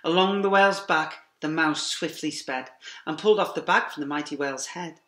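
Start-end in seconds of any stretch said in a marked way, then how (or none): none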